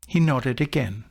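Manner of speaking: plain reading voice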